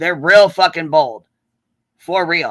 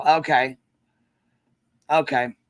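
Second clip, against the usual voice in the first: ordinary delivery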